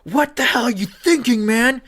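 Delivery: high, loud incredulous yelling at the player; commentator's yell. Retold or imitated. Commentator yelling at a quarterback over an interception. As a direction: bellow it like an outraged TV commentator. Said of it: "he yells".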